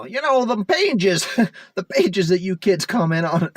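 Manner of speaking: put-on high, loud cranky old man. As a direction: play a grumpy old-timer scolding kids. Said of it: "I'm totally sounding like an old man right now".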